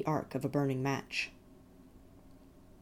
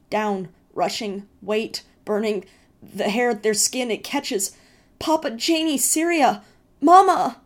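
rising to loud, high screams; building into a terrified scream for Mama; visionary boy's mounting panic, ending in a scream; panicked crying out; 7 semitones higher, 9 dB louder, slower than usual. A narrator's reading. Brother's vision of fire.

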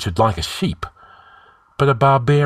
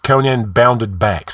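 usual speaking voice

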